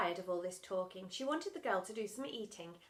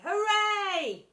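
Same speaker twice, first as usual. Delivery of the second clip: 1 cheer; 11 semitones higher, 11 dB louder than usual. Acted. Dinner ladies cheer.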